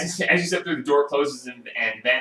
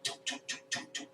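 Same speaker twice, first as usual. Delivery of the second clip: knocking footsteps sound; 19 dB quieter, faster than usual. Acted. Footsteps approaching behind door.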